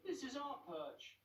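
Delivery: high, slow grumbling pigeon; slow territorial grumble; scruffy pigeons reclaiming the perch; grumbled. Acted. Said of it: "they grumbled".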